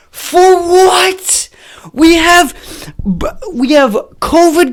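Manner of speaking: exasperated caricature crying out at the bombing; outraged leftie. Retold or imitated. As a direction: drag out the disbelief, mocking the left. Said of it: "people from the left were like".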